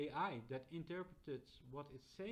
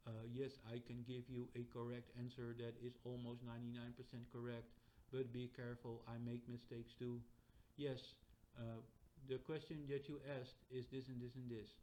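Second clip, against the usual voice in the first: even, monotone assistant recital; robotic chatbot. Acted. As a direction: recite answers like an unfeeling machine. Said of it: "That's not how chat G P T talks".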